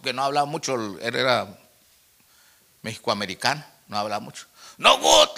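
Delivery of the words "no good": high, loud imitation of complaining; mock tearful wail. New convert telling his pastor it fails, mock tearful wail. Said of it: "y se puso a llorar".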